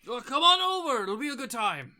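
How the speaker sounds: high, loud call across the room; tavern patron hollering. Acted. Dark-skinned man invites strangers to his table, hollering. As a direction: coax them over at full volume. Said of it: "he says".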